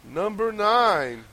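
slow, drawn-out chart countdown; countdown announcer. Acted.

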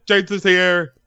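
high-pitched yelled announcement; excited yell. Retold or imitated. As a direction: call it out to the whole room. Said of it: "I actually did yell at the T V".

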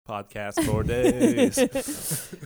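high, slow laugh through the words. Real, not acted. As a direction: giggle it out. Laughing reply.

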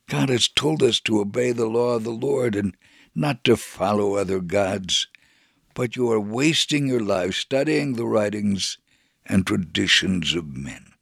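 ordinary talk

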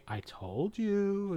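slow, lilting, quietly smug told-you; drawn out like a gentle sing-song; calm told-you. Retold or imitated. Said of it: "in his own voice".

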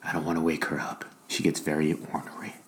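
very soft, hushed voice; whispering. Real, not acted. Father afraid of waking his ornery daughter, whispering. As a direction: speak softly so she stays asleep. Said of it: "What are you whispering for?".

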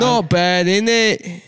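high, loud Aussie caricature; Australian accent. Acted. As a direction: push the mock Australian way up high. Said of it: "who's Australian".

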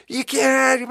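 panicked protest. Retold or imitated. Waiter pressuring the chef, high-pitched panic. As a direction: cut him off in a panic.

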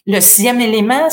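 usual speaking voice

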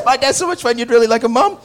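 put-on boy raving about his dad; eager kid. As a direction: sell his dad to mom. Son earnestly praising his dad.

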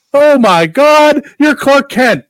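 high, loud and slow, stunned; shocked exclamation. Retold or imitated. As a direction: gasp it out in total shock. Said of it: "He goes".

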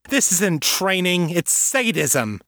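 high, indignant mother; outraged, pitch lifted; angry accusation. A narrator's reading. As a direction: accuse him, stretching the last words slowly. Mother confronts father in anger.

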